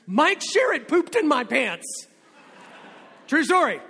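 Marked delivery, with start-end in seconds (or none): 0.1-2.1 loud, high, drawn-out panicked wail, acted; mock tearful outcry. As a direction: cry it out like an accused kid, hammed-up. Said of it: "he cried out with tears".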